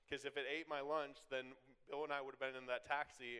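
ordinary talk

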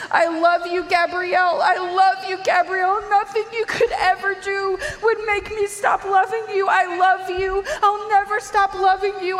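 high, loud, sobbing declaration of love; weeping. Retold or imitated. Mother holding her fallen daughter, weeping out her love. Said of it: "She's weeping".

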